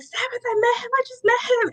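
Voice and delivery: high, overwhelmed mock-crying voice; retold tearful excitement. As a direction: imitate breaking the news through tears. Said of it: "I'm crying".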